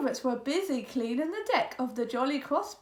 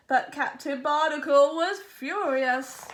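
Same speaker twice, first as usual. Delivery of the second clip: plain reading voice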